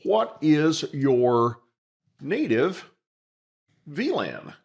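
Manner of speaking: each word drawn out slowly while typing; slow typing dictation of "What is your native V lan?". Shown as a demonstration. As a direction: spell it out slowly as you type. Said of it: "I'm gonna say".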